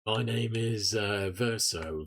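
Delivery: slow, grizzled English game hero; slow English character voice; Ben Starr as Verso. Acted. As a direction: draw it out like a video-game lead. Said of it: "it's a Ben Starr voice".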